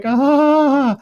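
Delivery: high, drawn-out "ah"; mock startled cry. Recapping the old lady seeing him, mock-startled cry.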